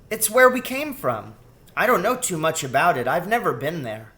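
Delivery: put-on high, lively child's voice; piping little-girl explanation; girl rat explaining. A narrator's reading. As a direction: start high and explain like a youngster.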